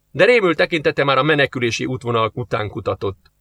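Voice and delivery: ordinary delivery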